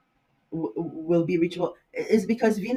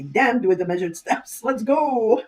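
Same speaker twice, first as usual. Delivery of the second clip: mock-impatient cry, rising much higher on the end; impatient Aquarius; 5 semitones higher, 5 dB louder than usual. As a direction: voice a reckless Aquarius raring to charge ahead. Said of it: "even though Aquarius can be".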